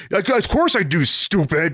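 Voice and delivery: high-pitched mock contempt; exaggerated irritated comeback; snippy tone. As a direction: answer as if the question is idiotic. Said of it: "it's just his tone".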